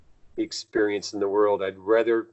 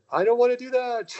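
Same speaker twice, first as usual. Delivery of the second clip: put-on much higher, louder childish whine; whiny refusal; 5 dB louder than usual. Mocking his own refusal to the guides, whiny. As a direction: whine it like a kid refusing chores. Retold refusal, then laughs.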